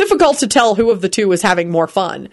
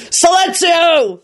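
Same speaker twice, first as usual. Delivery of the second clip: high, slow, forceful roar; roared spell; 11 semitones higher, much slower than usual. A narrator's reading. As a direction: command it over the noise. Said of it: "Lupin roared".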